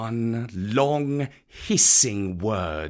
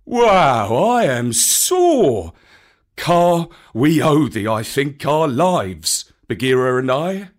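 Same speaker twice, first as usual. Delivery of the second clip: put-on sore old bear; 2 semitones higher, 6 dB louder, slower than usual. A narrator's reading. Baloo, bruised, thanking Kaa loudly. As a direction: groan it out, aching all over.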